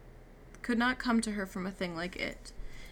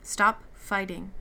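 slow, louder firm order; firm slow command; 6 dB louder, slower than usual. A narrator's reading. Beast telling struggling Meg, firm and slow. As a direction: tell her firmly and slowly to stop. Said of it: "the beast told her".

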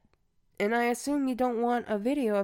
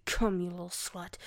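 ordinary talk

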